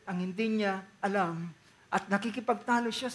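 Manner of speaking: ordinary talk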